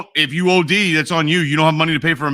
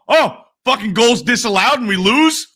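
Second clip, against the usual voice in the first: mock angry fan; 6 semitones higher than usual. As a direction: rage like a fan whose goal just got disallowed. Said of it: "that's my mentality".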